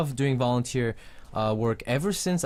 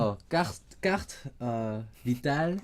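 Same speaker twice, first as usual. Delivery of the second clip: French accent; 3 dB quieter than usual. Shown as a demonstration. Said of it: "How do you say that in a French accent?".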